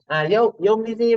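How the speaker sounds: ordinary talk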